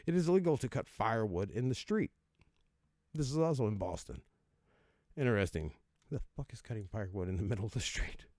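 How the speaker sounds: ordinary delivery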